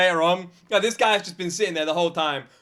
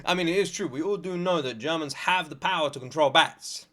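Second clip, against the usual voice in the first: ordinary talk, nothing marked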